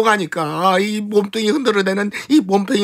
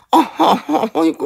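high whimpering moan; 8 semitones higher than usual. Acted. Servant moans while hugged.

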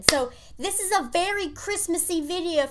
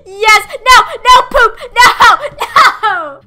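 much louder and higher shouted nos; frantic yells; 8 semitones higher, 14 dB louder, much slower than usual. Real, not acted. Gamer watching the trap fail, yelling no. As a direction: yell each no in dismay. Fails jump, yelling in game.

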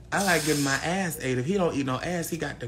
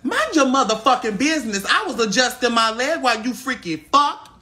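high, loud and sassy; loud snap; 8 semitones higher, 10 dB louder than usual. Real, not acted. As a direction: snap back at them loud. Snapping back at friend.

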